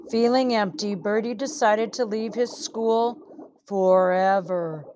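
plain reading voice

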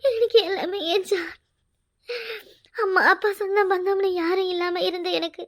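crying through every phrase; sobbing. Acted. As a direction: weep out the loss. Sobbing over abandonment.

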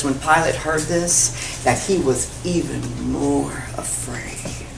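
slow, low, fading to a whisper; hushed reading. A narrator's reading. Preacher reads that Pilate is afraid, hushed. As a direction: read the verse with hushed weight.

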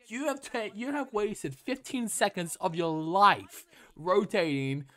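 exasperated yelling at a pointless mistake; angry rant. Real, not acted. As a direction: tear into the player's rotation at full volume. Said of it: "I'm getting angry".

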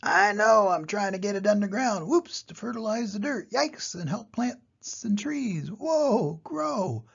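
high, wobbling cries as ball escapes; flustered panic. Acted. Steve loses control of his ball, high panicked cries. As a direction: scramble and yelp. Ball of poo rolling away.

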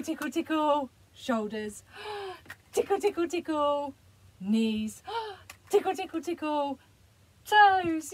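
high, bubbly tickle-game voice with gasps; playful excitement for a small child; excited play voice. Shown as a demonstration. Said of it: "So I'll demonstrate it for you".

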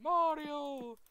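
high, sweet princess calling Mario; cartoon princess. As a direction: call out like Princess Peach. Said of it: "do your best Princess Peach".

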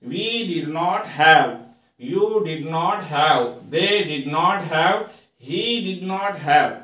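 slow, clear model sentence; slow drill reading. Shown as a demonstration. Teacher slowly models the negative sentence for students. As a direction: read it out slowly for the class. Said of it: "यहाँ पर sentences देखिए".

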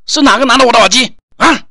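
loud, angry questioning; angry demand. Acted. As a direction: demand it back angrily. Demanding stolen earphones back.

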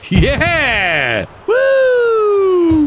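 real shout of triumph; victory whoop. Real, not acted. Team wins the festival.

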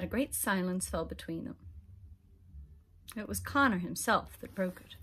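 ordinary delivery

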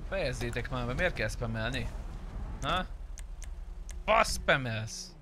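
ordinary talk, nothing marked

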